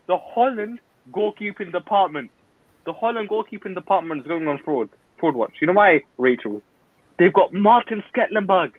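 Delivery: ordinary delivery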